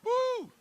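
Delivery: high excited whoop; cheering whoop. Real, not acted. Speaker cheers on taking stage.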